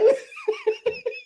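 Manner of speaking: high squealing giggle. Real, not acted. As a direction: giggle brightly. Laughing at her own joke.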